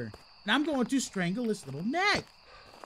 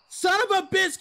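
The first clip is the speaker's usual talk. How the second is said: real outburst; 9 semitones higher, 6 dB louder than usual. Real, not acted. Startled, drops camera.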